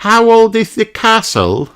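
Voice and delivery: high piglet voice; Peppa asking. A narrator's reading. Peppa asking Mummy how far, high piglet voice. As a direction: ask as the impatient little pig. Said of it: "asked Peppa".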